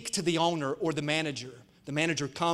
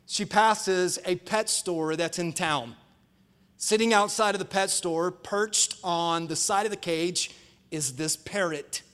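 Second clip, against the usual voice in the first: normal talking voice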